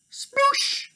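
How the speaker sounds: very high splash noise; high sploosh effect; splash sound. Acted. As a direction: make a silly splash. Water sound.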